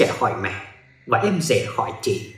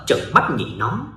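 usual speaking voice

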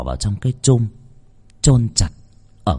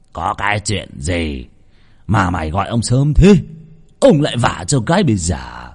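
put-on grumpy old master. A narrator's reading. Grumpy old master woken early.

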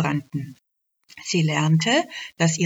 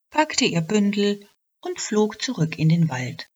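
ordinary delivery

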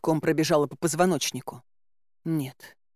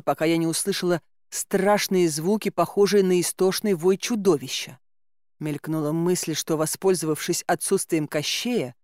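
ordinary delivery

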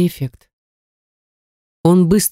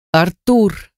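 demanding shout; 3 semitones higher than usual. A narrator's reading. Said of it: "крикнула требовательно".

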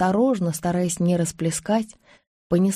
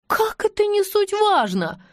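"Как это не суть важно" very high, slow, incredulous; dumbfounded question; 13 semitones higher, slower than usual. A narrator's reading. Anush echoes Silva, "не суть важно" in high disbelief. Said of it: "глупо спросила Ануш".

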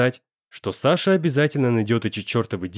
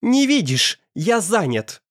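angry snap; 8 semitones higher, slower than usual. A narrator's reading. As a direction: bark it at the door. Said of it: "Злобно зарычал он".

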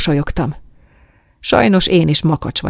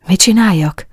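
higher-pitched, mildly baffled question; puzzled question; 4 semitones higher, 4 dB louder than usual. A narrator's reading. Man asking the woman what he should do, puzzled. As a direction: ask it with a touch of bewilderment. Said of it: "Nézett rám értetlenül".

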